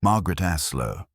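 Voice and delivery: plain reading voice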